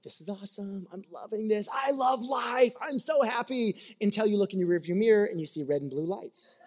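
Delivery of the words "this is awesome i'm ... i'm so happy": carefree gushing, "I love life" stretched out; gleeful driver. Pretend. Driver in a new sports car, gushing happily. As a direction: revel in the joyride.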